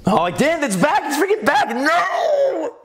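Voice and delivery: high-pitched dismayed outcry, building; comic horror. Real, not acted. Host hearing the old accent return, comically horrified. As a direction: cry out as the dreaded thing returns.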